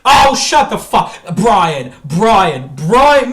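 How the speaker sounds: loud, high exasperated yelling; outraged bellow of his name; furious shout. Real, not acted. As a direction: yell at him, fed up. Furious rant at Brian.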